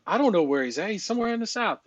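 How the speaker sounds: lightly voiced Northeasterner, pitch climbing high; Northeastern buddy. Telling a buddy where the caller is, mildly imitated Northeasterner. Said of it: "how they'll probably say it to their buddies".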